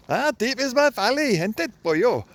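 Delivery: high, loud hammed-up Welsh accent; sing-song mock Welsh lilt. Vlogger mocks the Welsh to his viewers, hammed-up Welsh accent. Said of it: "Why do they talk like that? That's not even Welsh".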